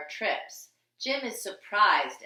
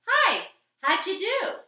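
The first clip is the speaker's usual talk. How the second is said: higher, louder friendly greeting; cheery boss; 9 semitones higher, 5 dB louder than usual. Acted. Boss Lou cheerfully greeting the driver and dog. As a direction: greet them like a jolly boss. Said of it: "our boss named Lou says".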